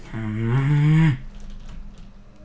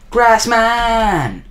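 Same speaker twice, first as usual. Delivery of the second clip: mock battle cry; 8 semitones higher, 5 dB louder than usual. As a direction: call out grass man high and loud. Calling out the game enemy.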